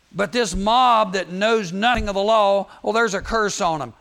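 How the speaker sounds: loud, scornful denunciation; acted outrage, condemning the crowd; mad. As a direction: thunder it out as an indignant official. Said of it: "The Pharisees get mad".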